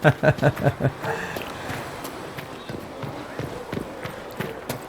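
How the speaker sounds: quiet, breathy run of laughter; soft chuckle. Real, not acted. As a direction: player chuckling after saving before dialogue pops up, soft chuckle. Player amused by own save remark.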